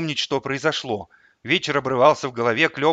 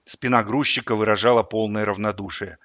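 ordinary delivery, nothing marked

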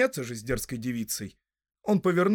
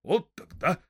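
higher-pitched burst of recognition; surprised exclamation; 5 semitones higher, slower than usual. A narrator's reading. Farmer recognising the returned hobbit, surprised exclamation. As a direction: exclaim as you recognise an old acquaintance. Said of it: "воскликнул Недосёлок".

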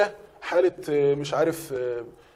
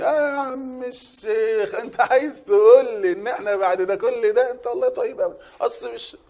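high, slow, sneering voice; mocking scoffer; 7 semitones higher, 3 dB louder, slower than usual. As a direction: drawl it out like someone mocking the sheikh. (صوت مستهزئ ساخر مرتفع وبطيء)

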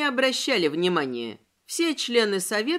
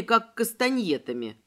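usual speaking voice

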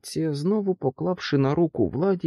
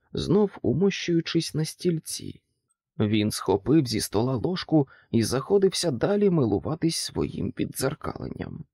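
plain reading voice

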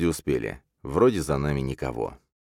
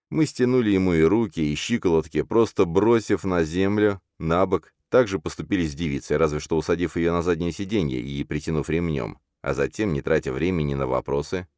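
ordinary delivery

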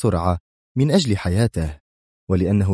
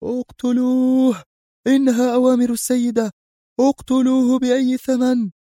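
Ronaldo screaming. Acted. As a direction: yell the kill order at the top of the voice. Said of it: "فصرخ رونالدو بكل قوته".

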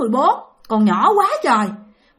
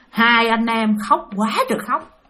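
usual speaking voice

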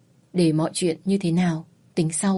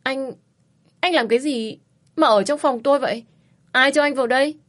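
flustered, higher stammering demand; startled stammer; 6 semitones higher than usual. A narrator's reading. Woman startled by man in her room, stammering. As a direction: stammer out, shocked to find him there. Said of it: "lắp bắp hỏi".

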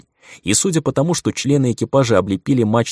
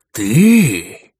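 surprised exclamation; 2 semitones higher, 7 dB louder than usual. Acted. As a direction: blurt it out in shock. Stranger recognizes hero, surprised. (удивлённый возглас)

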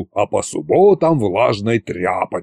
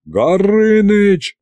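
high, drawn-out shout of the name; exasperated yell; 10 semitones higher, 3 dB louder, much slower than usual. A narrator's reading. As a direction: cry out to cut the dragon off. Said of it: "заорали все разом".

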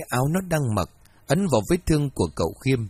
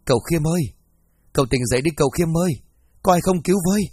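higher, louder panicked calling; frantic cry for help; 5 semitones higher, 3 dB louder, faster than usual. A narrator's reading. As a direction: scream for help in the night. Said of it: "Tiếng gọi thất thanh".

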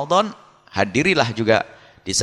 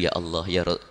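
softer, humble confiding prayer; quiet private plea; 5 semitones lower, 6 dB quieter than usual. Pretend. A believer alone in his room, confiding quietly to God. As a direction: pour out your heart softly to God. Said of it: "Bisa ndak ngadu".

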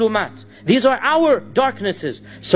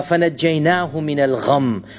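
Quranic recitation; 5 semitones lower, much faster than usual. Acted.

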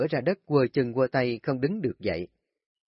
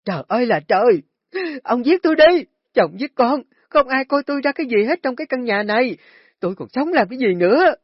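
shrill, much higher and louder hysterical wailing; wailing scream; 12 semitones higher, 9 dB louder than usual. Acted. Wife rolling on the floor wailing at husband, hysterical screaming. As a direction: throw a tantrum, screaming to the heavens. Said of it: "la khóc ầm ĩ".

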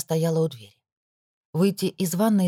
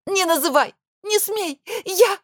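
high, breaking shrieks; hysterical scream; 3 dB louder, slower than usual. Acted. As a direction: scream through panic. Продолжение истошного крика.

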